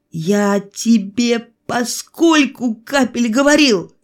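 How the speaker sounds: choked, drawn-out disbelief; strangled voice. A narrator's reading. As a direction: squeeze the words out, appalled. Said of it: "задушенным голосом заговорил я".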